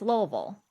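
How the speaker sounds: "Louisville." swallowed to LOH-vul; swallowed pronunciation. Shown as a demonstration. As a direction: squash "Louisville." into two syllables. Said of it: "You swallow half the sentence".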